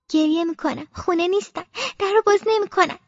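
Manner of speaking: plain reading voice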